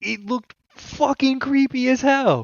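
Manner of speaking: high, slow and drawn out; excited. Real, not acted. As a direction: stretch it out in excited awe. Excited about creepy trailer.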